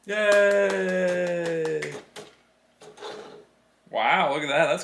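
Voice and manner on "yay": 1 cheer. Real, not acted. Dad cheers.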